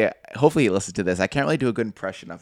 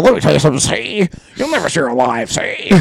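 higher, louder wisecracking mobster; old movie gangster; 7 semitones higher, 5 dB louder than usual. Acted. Friend showing a proper gangster impression, higher and louder. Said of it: "That was good".

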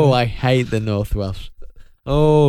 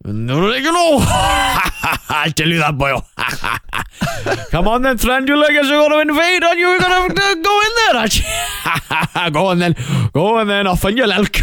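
hammed-up North Welsh, rising higher; North Welsh character; 11 semitones higher than usual. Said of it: "a new character I'm bringing in".

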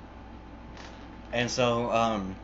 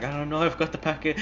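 high, hurried whimpering impression; imitated crying girlfriend; 6 semitones higher, much faster than usual. Girlfriend answering her yelling boyfriend, mock tearful and high. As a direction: play a tearful girlfriend, quickly. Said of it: "the girl's fucking crying".

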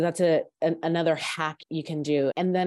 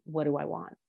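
softly asking yourself "What do I want?"; quiet inner question; 2 semitones lower, 5 dB quieter than usual. Shown as a demonstration. Coach showing the inner question, asked softly. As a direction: turn inward and ask "What do I want?" gently. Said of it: "ask that important question".

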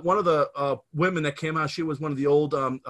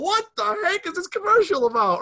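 much higher, louder, incredulous; baffled teens; 5 dB louder than usual. Retold or imitated. Teen friends reacting to the racy ad, high incredulous. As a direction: squeal it in disbelief. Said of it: "we're going".

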